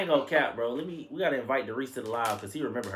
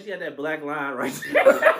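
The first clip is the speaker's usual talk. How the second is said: normal talking voice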